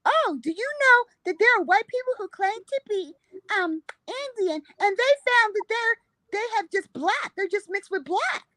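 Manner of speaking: high, fussy lady voice. Retold or imitated.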